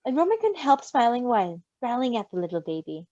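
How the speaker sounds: plain reading voice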